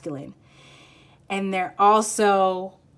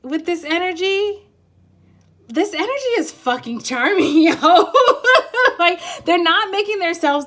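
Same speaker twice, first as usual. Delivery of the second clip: higher and louder, laughing as she gushes; giggle, swept up by the charm; blushing laugh; 9 semitones higher, 8 dB louder than usual. Real, not acted. Reader gushing about a charming energy, laughing.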